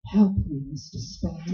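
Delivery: mimicking her pleading throb; mock throbbing plea. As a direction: throw her plea back at her, mockingly. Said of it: "that throb you get in your voice".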